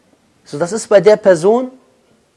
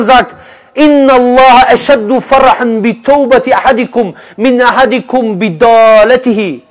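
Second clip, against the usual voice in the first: loud, urgent preaching, raised and insistent; preaching at full force; 4 semitones higher, 7 dB louder than usual. Acted. Preacher urging listeners to return to God, loud full-force preaching. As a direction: drive the call home to the congregation.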